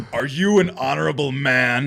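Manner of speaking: lightly voiced anime hero, slow; overacted anime dub. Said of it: "let me quote that".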